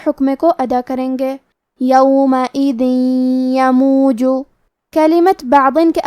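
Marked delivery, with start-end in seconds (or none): 1.8-4.2 یومئذ یموج said slowly, the tanween merged into ی with nasal ghunna; tajweed demo. Shown as a demonstration. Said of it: "تو ہم یہ تنوین کو یا میں ملا کے غنہ اور تشدید". (غنہ کے ساتھ یومئذ یموج)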